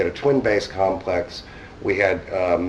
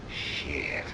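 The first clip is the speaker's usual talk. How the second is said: breathy under-the-breath curse; disappointed whispered aside; 6 semitones lower, 13 dB quieter than usual. Retold or imitated. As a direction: mutter it to yourself, deflated. Muttered reaction to bowling alley.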